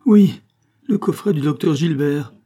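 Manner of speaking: loud, high burst on "Oui", then falling; anguished outcry. A narrator's reading. Farmer confirming the loss to his wife, anguished cry. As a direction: cry it out in distress. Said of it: "s'écria Billaud".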